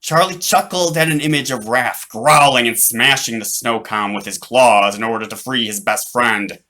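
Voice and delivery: plain reading voice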